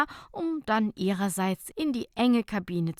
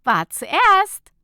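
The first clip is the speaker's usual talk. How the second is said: much higher, much louder; triumphant claim; 12 semitones higher, 13 dB louder than usual. Acted. Nicola claiming the cabin first, triumphant claim. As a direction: claim it playfully.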